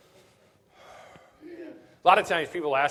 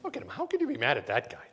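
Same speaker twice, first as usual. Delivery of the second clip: ordinary delivery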